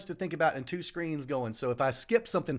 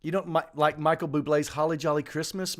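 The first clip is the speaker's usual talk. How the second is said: ordinary talk, nothing marked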